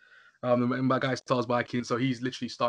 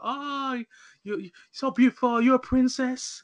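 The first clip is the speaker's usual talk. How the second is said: higher, fawning compliments; higher, slow on princess, adoring; admiring gush; 11 semitones higher, 3 dB louder, slower than usual. Retold or imitated. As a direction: coo over her like a princess. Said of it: "Everyone's like".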